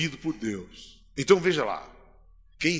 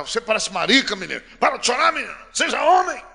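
building shout, much higher and louder; father shouting; 7 semitones higher, 5 dB louder than usual. Retold or imitated. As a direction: yell it like a harsh father scolding his son. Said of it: "o pai já". (pai gritando com o filho, cada vez mais alto)

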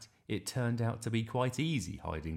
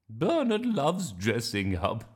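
loud, slow, chuckling grandma voice; 7 semitones higher, 6 dB louder than usual. Acted. Grandma during dressing up, loud and chuckling. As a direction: draw it out fondly, then chuckle like a granny. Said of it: "said Grandma".

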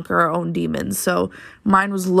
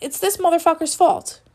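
angry; 10 semitones higher than usual. Retold or imitated. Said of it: "So I'm like".

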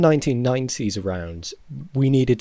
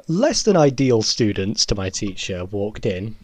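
ordinary talk

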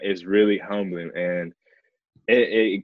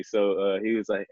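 ordinary talk, nothing marked

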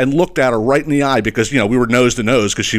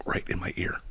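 breathy whisper, almost no voice; a whispered aside; 6 semitones lower, 15 dB quieter than usual. Retold or imitated. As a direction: hush it like she's at your ear. Gum chewer right at his ear.

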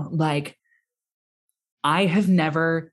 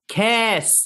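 yelled; 7 semitones higher than usual. Retold or imitated. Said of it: "I yelled".

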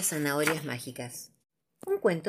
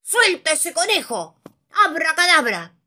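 cracked wizard voice; 10 semitones higher, 7 dB louder than usual. Acted. Hilario ordering the dragon to release the rabbit, loud. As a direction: cast the spell with authority. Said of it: "una voz ronca y medio cascada".

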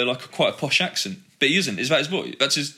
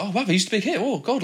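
higher-pitched mock amazement; delighted surprise; 6 semitones higher than usual. Viewer recalling spotting a big hit, pleasantly surprised. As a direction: re-enact a pleased little wow. Acting out own excited reaction.

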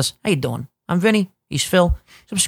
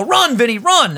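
high, excited call of "Run, Vinny, run"; a fan's mock cheer, pitched up; 8 semitones higher, 3 dB louder than usual. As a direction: cheer him on like a fan in the stands. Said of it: "they literally go".

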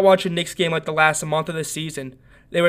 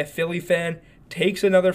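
ordinary talk

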